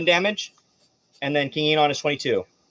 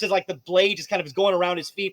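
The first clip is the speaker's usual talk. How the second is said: normal talking voice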